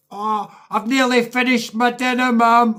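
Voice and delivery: put-on high, loud little boy fibbing to mum; young son telling mum he has finished dinner, high and loud; little kid voice.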